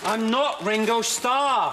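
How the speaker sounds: exasperated denial. Acted. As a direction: spell it out in exasperation. Exasperated denial to pestering fan.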